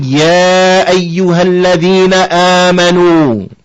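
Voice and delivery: brisk chanted Arabic recitation; Quran recitation. Shown as a demonstration. Teacher quotes the verse opening in recitation.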